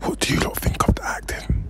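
breathy hushed whisper. Real, not acted. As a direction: whisper near a film set. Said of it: "I fully have to whisper".

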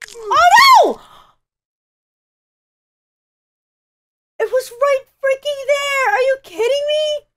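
high, loud anguished yell; real exasperated cry; frustrated shout. Real, not acted. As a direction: rage at the miss. Falls just short again.

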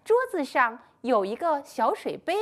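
ordinary talk, nothing marked

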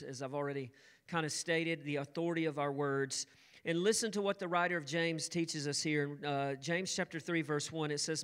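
ordinary talk

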